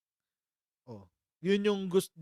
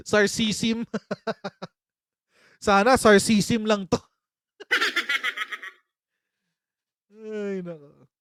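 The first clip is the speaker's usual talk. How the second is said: loud, higher, through laughter; laughing; 4 semitones higher, 6 dB louder, slower than usual. Real, not acted. Host hoping the statement is sarcasm, laughing hard. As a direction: say it while cracking up. Cracking up at own hope.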